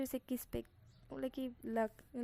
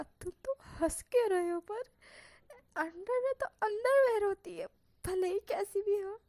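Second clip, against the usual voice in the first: indignant protest; 9 semitones higher, 7 dB louder than usual. Acted. Wing protests being laughed at.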